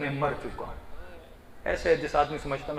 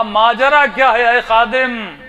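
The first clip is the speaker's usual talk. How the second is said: slow, loud, high declaration; booming slow address to the servant; majestic proclamation; 8 semitones higher, 15 dB louder, slower than usual. Acted. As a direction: proclaim it like a revered Imam. Imam's majestic address in vision.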